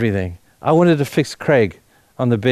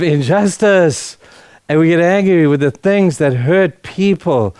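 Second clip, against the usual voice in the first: higher and louder, driving hard on angry; impassioned preaching; 5 semitones higher, 3 dB louder than usual. Real, not acted. Pastor rousing the church against injustice, impassioned and loud. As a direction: preach it with rising fire.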